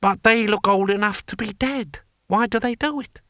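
high-pitched, incredulous official; bewildered taxman. A narrator's reading. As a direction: question it in a high, baffled character voice.

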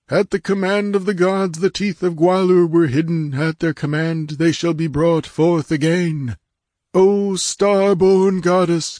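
high, ringing priestly declamation; exalted proclamation. A narrator's reading. Priest hails the gods' will and begs the goddess's leave, exalted. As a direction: declaim it with fanatical reverence. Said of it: "he cried in an exalted voice".

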